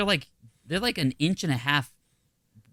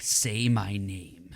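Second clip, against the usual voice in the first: slow, low menacing hush; Heisenberg; 4 semitones lower, 5 dB quieter, much slower than usual. Pretend. Gardener posing as Heisenberg before the bees, low and slow. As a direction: drag each word out darkly. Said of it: "I'm like".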